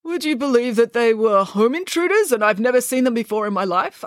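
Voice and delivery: prim, pompous father laying down rules; a fussy lawyer giving orders; father instructing the children before a visit, pompous; stiff lawyer father. Acted. Mister Green voiced.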